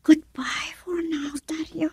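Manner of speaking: slow, soft, breathy goodbye; whispered goodbye. Acted. Woman whispering her last goodbye to Dario. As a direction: let it drift away in a whisper. Farewell to lover.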